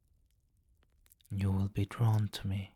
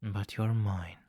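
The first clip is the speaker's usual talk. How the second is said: ordinary talk, nothing marked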